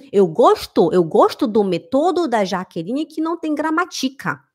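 imitated German accent in Portuguese, stresses shifted; mock a foreigner's faulty Portuguese pronunciation; wrongly stressed foreign Portuguese. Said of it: "É assim que eles escutam quando a gente fala errado".

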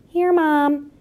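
put-on high, piping child's reply; little child answering. A narrator's reading. Young mouse answers mother's roll call, piping. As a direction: pipe up high as a small child.

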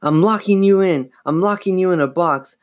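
high, urgent declaration fading at the end; panicking. A narrator's reading. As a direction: blurt it in panic, then falter.

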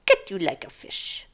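whispered mobster threat; mobbish threat. Acted. Knife-puller threatening complaining customer, whispered mobbish threat. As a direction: whisper it like a movie gangster. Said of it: "I'm very mobbish right now".

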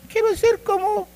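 high-pitched mock fan voice; mimicked wannabe in a squeaky-high pitch; adoring fan. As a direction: gush like a starstruck follower, up high. Acting out an idol worshipper.

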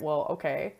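normal talking voice

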